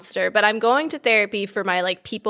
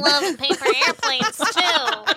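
higher, swooning Amanda voice; imitating Amanda; 4 semitones higher than usual. Mocking Amanda as she swoons over paper airplanes. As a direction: lovestruck put-on voice. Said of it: "She was like".

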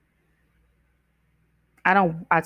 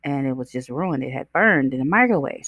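normal talking voice

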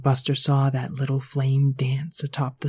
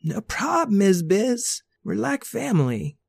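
laid-back dude voice; 7 semitones higher than usual. A narrator's reading. Randall high casual voice.

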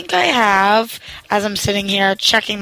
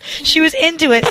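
higher, lively second reading; expressive re-read; 7 semitones higher than usual. Shown as a demonstration. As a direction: read it again with feeling. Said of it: "sorry that I read like a five-year-old".

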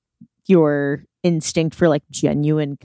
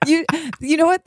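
high giggle then words; laughing; 11 semitones higher than usual. Real, not acted. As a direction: giggle your way into the line. Laughs into new idea.

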